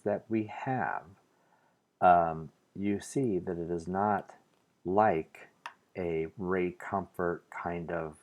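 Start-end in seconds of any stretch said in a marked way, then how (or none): none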